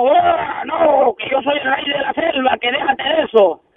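high-pitched indignant lion refusing outright; acted outrage from the king of the jungle; pissed-off lion. Lion refusing the donkey's proposal, pissed off. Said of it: "el león le dice encojonado".

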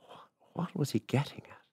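hushed, breathless whisper of an uneasy thought. A narrator's reading. Woman wondering what the farmer means, whispered. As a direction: breathe the question out, voiceless.

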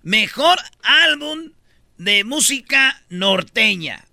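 slow, drawn-out, each word weighted; slow emphatic announcement. Shown as a demonstration. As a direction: announce it slowly for effect. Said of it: "escúchate bien esto".

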